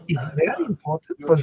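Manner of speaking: put-on Viennese Freud accent; demonstrating a Viennese psychoanalyst's accent. Shown as a demonstration. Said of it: "I'd put on the accent".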